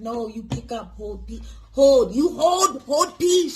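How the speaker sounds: put-on the shop lady losing patience in a Chinese accent; imitated angry shopkeeper, Chinese accent; mock angry Chinese accent. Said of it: "She was getting mad".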